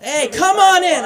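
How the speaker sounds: loud, slow call to the latecomers; loud "come on in" to people arriving late; loud welcome. Real, not acted. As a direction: call out a welcome across the room, slowly. Greets latecomers walking in.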